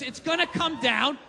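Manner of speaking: ordinary talk, nothing marked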